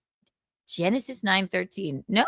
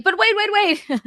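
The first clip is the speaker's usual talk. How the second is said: 1 mock reporter; 10 semitones higher, 4 dB louder than usual. Mocking the press reaction.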